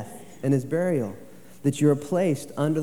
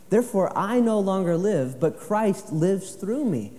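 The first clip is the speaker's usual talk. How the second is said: higher, louder, slowing at the end; forceful preaching; 7 semitones higher, 3 dB louder than usual. Acted. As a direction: proclaim it, slowing on the last phrase. Preaching Galatians.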